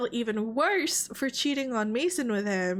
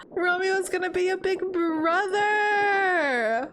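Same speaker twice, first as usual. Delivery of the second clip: overjoyed; 7 semitones higher, 3 dB louder, slower than usual. Real, not acted. Overjoyed at baby news.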